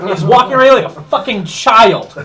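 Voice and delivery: high, loud in-character rant; mock yelling. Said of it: "Are you done yelling at me".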